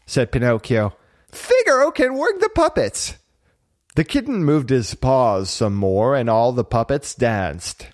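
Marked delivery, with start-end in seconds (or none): 1.3-3.2 put-on very high boyish excitement; excited puppet boy. A narrator's reading. Pinocchio watching the puppets dance, excited. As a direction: announce the discovery high and thrilled.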